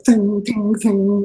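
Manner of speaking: high ringing metallic tings; ping like arrows glancing off armour; arrow ricochet noise. Acted. Imitates arrows bouncing off armour.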